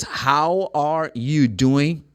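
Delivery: very slow, each word fully separate; fully pronounced, unlinked words. Shown as a demonstration. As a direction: say it slowly like a careful learner. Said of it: "can I say like this".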